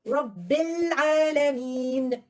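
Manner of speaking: very slow, drawn-out Quran reading; slow connected recitation. Shown as a demonstration. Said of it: "I'm gonna start it".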